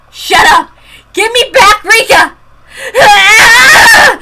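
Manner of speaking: high furious shouts through tears; crying rage. Acted. Girl threatening the doctors, crying rage. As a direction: scream the threat, losing control.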